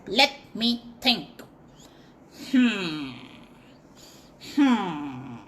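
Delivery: low musing hums; thoughtful monkey. Acted. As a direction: think it over slowly. Monkey pondering.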